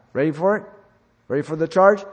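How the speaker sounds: rising battle cry, loud and high; rally the troops like a commanding general; rallying call. Acted. Preacher rallying his congregation like a general, loud and rising.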